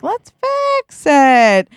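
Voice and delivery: high, drawn-out eager urging; insistent repeat. Acted. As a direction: stretch every word in a sing-song push.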